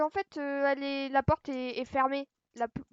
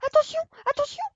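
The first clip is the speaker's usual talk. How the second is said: loud alarmed yelling; panicked shouting; 12 semitones higher, 10 dB louder, faster than usual. Real, not acted. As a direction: shout a frantic warning. Monstre surgit, il crie. (cri de panique)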